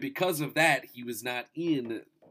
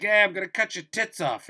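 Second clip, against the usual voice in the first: raised, loud mock menace; hammed-up threat, pitched up; mock villain threat; 10 semitones higher, 8 dB louder than usual. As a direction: threaten loudly in a put-on villain voice.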